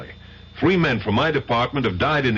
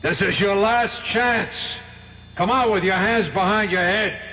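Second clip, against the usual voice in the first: calling out an order; 11 semitones higher than usual. Acted. Police calling out to suspect.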